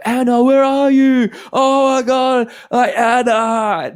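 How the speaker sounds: high, loud drawn-out mock wail; imitated yelling. Roommate starting up hours later, imitated yelling. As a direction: holler for the ex, imitating his raving. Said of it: "same bullshit".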